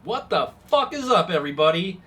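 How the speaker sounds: put-on high, loud kid greeting; a cheeky eleven-year-old shouting hello; bratty little girl. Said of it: "turns into her little girl self and goes".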